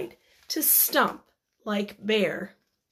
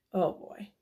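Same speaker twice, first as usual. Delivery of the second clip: softer, quick murmured aside; quiet aside; 5 semitones lower, 9 dB quieter, much faster than usual. Real, not acted.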